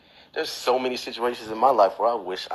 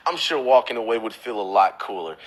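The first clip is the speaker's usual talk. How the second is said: normal talking voice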